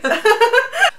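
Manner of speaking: high, rising burst of laughter; loud laugh. Real, not acted. Laughs after greeting the viewer.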